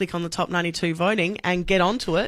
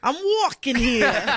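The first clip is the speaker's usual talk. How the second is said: put-on slow, laughing New York accent; 4 semitones higher, much slower than usual. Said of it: "Go on, [name], give it a go".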